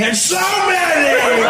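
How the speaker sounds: sudden high, loud swoop on SO MANY; Donald Trump. Acted. President mid-speech, voice unpredictably soaring high. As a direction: leap up in pitch and stretch SO MANY out. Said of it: "It was always high".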